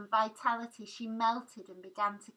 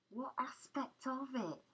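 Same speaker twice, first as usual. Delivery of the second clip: lightly mocking question, a touch higher; 5 semitones higher, 4 dB quieter than usual. A narrator's reading. Husband taunting his frightened wife, mildly mocking. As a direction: tease her with the question. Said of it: "he said mockingly".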